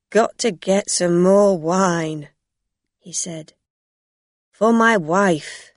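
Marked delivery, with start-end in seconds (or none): 0.1-2.3 put-on loud drunk. Drunk man leaving. 4.5-5.7 put-on loud drunk. Drunk man leaving.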